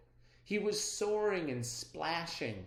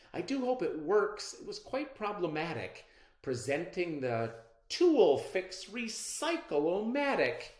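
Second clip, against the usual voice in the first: hopeful inventor; 6 semitones higher, 5 dB louder than usual. A narrator's reading. Inventor voices a worried hope.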